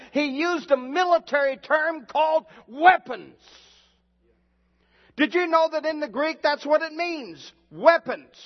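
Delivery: high, loud, slowed sermon cadence with shouted peaks; preaching at full force. Acted. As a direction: thunder the scripture over the congregation.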